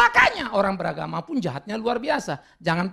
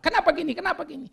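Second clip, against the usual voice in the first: complaining questioner; 9 semitones higher, faster than usual. Retold or imitated. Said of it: "kalau kau pertanyakan planning Allah".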